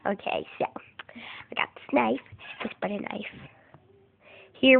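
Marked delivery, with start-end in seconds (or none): 1.1-3.3 whisper. Real, not acted. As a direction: hush it conspiratorially. Whispering to camera.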